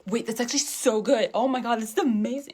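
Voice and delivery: over-the-top amazement. Acted. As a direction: gush like a food reviewer. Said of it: "parodying influencer".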